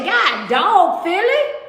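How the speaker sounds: high exclamation rising sharply on Philly; mock exasperation. Host telling off bullying Philly fans, mock exasperated. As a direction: exclaim it, pitch shooting up at the end.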